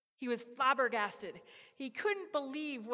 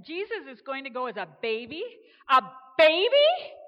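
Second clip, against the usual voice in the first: rising to a much louder, higher shocked cry; astonished; 3 semitones higher, 15 dB louder than usual. Acted. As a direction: build to an incredulous shout. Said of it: "eyes almost popped out".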